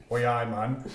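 low Geordie interjection; put-on Newcastle accent, low; strong Newcastle accent. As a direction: lay on broad Geordie, pitched low. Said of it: "Not as strong as Newcastle or Sunderland".

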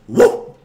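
sudden loud woo; loud whoop. Real, not acted. Guest ending a rant on nothingness, loud whoop.

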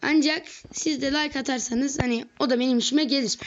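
usual speaking voice

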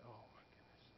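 breathy whisper under the breath; whispered dismay. Retold or imitated. Pastor thinks to himself at low appraisal, whispered. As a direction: mutter it to yourself in dismay.

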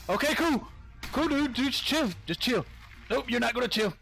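high tense voice trying to stay calm; nervous self-calming. Real, not acted. Player near Titan boa, nervous high self-talk. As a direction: talk yourself down while scared.

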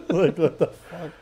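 normal talking voice

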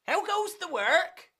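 high-pitched farmer's call. Acted. Farmer calls to duck repeatedly.

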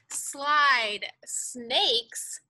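plain reading voice

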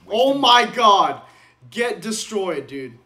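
shouting out in slow amazement; raging gamer. Real, not acted. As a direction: yell it like an outraged gamer. Player raging at enemy kill.

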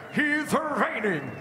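high, drawn-out ring announcer shout; arena announcer. Real, not acted. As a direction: build and stretch to the name. Champion introduction.

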